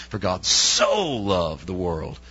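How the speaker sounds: "so" drawn out and weighted, very slow; stress on so. Shown as a demonstration. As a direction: say "so" as if in capitals. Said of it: "all caps those two letters".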